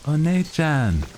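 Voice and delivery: squeaky tsundere anime schoolgirl; anime girl voice. Pretend. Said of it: "super high-pitched anime girl voice".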